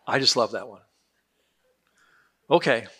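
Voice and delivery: ordinary delivery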